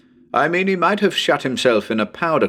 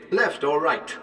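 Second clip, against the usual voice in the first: ordinary delivery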